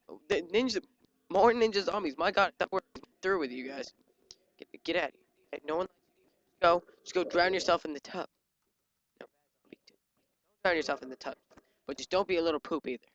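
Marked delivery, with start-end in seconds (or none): none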